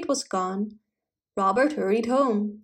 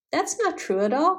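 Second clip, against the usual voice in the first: higher-pitched storybook protest; understated indignant cry, pitched up; mildly indignant; 7 semitones higher than usual. A narrator's reading. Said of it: "cried the fairy boy indignantly".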